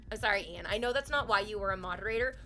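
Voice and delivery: ordinary delivery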